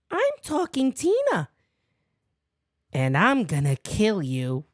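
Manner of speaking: eerie doll voice, dropping low on the threat; lightly voiced creepy doll. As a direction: voice a menacing talking doll. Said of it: "Talking Tina's a great episode".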